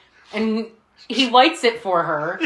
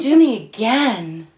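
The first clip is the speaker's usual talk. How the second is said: slow, louder breathy come-on, imitated; breathy seduction; 5 dB louder, slower than usual. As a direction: beg seductively like a knock-off Marilyn. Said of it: "It is very breathy and ooh".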